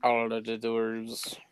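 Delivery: dwarf voice. Acted. Mimicking a dwarf voice.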